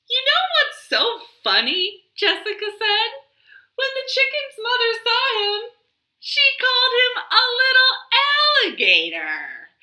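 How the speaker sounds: high, fast and giggly; amused child. Acted. Jessica telling the frogs the joke, high and fast. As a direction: tell it like a funny secret.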